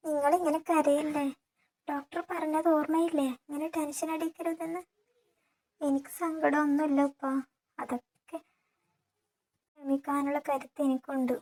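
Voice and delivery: lightly voiced young wife, reassuring; comforting young woman. A narrator's reading. Daughter-in-law comforts weeping father.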